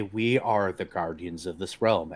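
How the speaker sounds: solemn guardian voice; solemn Koth. Acted. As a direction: intone it like an ancient guardian. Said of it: "go in at Erebane again and say".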